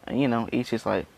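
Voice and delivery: usual speaking voice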